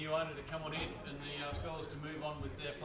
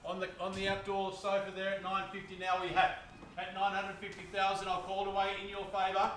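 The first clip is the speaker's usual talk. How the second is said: brisk auction call to the crowd; auctioneer calling bids; 2 semitones higher, 4 dB louder than usual. Real, not acted. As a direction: sell it loud to the room.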